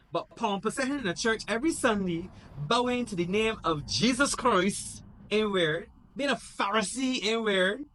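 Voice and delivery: high, loud indignant rant; mock-indignant high rant; Michael ranting. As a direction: lash out high-pitched at the churchgoer. Michael rants at brother.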